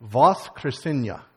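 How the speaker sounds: Voskresenia said slowly, syllable by syllable; slow Russian word. Shown as a demonstration. Pastor teaching the congregation a Russian word, slowly. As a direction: draw out Voskresenia slowly for the church to repeat. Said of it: "I'm gonna say it slowly".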